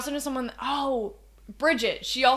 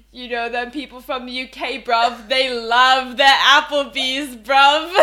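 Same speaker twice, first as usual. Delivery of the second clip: put-on silly British accent, louder on the last bruv; 2 semitones higher, 9 dB louder than usual. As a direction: ham up a British bloke. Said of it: "in a British accent".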